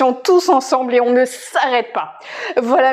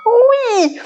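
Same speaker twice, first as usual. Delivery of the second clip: excited cheer; 8 semitones higher than usual. Real, not acted. Cheering as exercise ends.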